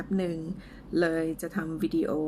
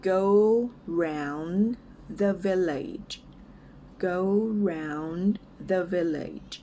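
word-by-word model for repeating, with pauses; slow and clear; much slower than usual. Shown as a demonstration. English teacher models a phrase for learners, slow and clear. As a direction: say it slowly, each word apart. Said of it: "ช้าๆ ชัดๆ ไปก่อนนะคะ".

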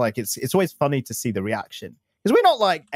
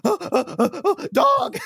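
excited squeal; slower than usual. Retold or imitated. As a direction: shriek with delight, building up.

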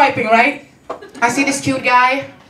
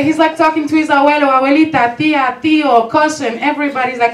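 usual speaking voice

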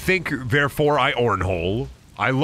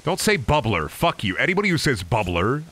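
normal talking voice

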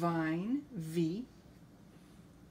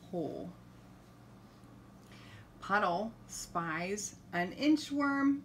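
ordinary delivery, nothing marked

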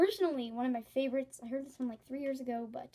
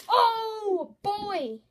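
much higher, loud mock shock; oh boy exclamation; 9 semitones higher, 10 dB louder than usual. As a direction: gasp it out like a stunned kid. Reacting to a harsh joke.

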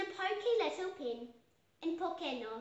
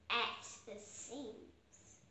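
plain reading voice

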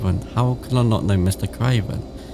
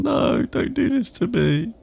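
high-pitched drawn-out plea; slow high whine at the game; dismayed pleading; slower than usual. Real, not acted.

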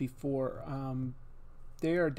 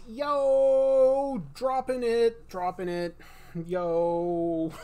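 hype shout; 6 semitones higher, 6 dB louder, much slower than usual. Real, not acted. Hype calls, silly mood.

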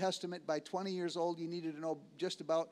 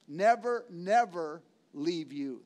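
Never punched loud, never leave you slower; emphatic never; 5 dB louder, slower than usual. Acted. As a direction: hit the first Never hard. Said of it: "the Greek says".

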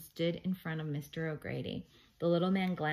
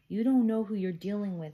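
louder, mildly pleading warning; 3 semitones higher, 7 dB louder than usual. A narrator's reading. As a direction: plead with a stubborn friend. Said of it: "Howie pleaded".

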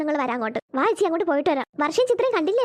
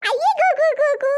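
high, singsong taunt; childish jeering; 6 semitones higher than usual. Acted. Child mocking a sibling, gleeful high jeer. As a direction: tease with rising mocking hoots.

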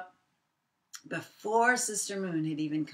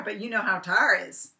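plain reading voice

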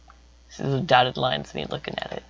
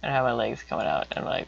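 ordinary delivery

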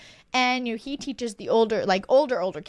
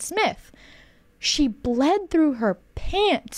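slow blunt correction; 5 semitones higher, much slower than usual. Retold or imitated. She corrects him bluntly.

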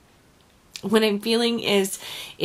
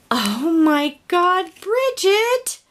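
high, loud delighted call of the name; thrilled, high exclamation; excited cry; 8 semitones higher, 5 dB louder, slower than usual. Real, not acted. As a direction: shout the friend's name in joyful shock. Discovering gift from friend.

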